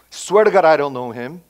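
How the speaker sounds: high, loud mock oath of denial; Paul Park disowning. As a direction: blurt out an imagined betrayal, loud. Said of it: "Can you imagine Pastor Paul Park disown me".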